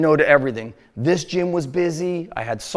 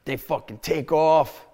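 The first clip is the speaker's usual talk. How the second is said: ordinary talk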